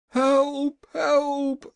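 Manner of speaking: high, loud cry for help; shrieking call for help; screamed plea. A narrator's reading. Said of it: "screamed the king".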